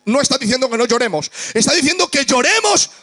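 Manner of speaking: full-voiced Pentecostal climax; fiery preaching. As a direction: cry it out to the congregation.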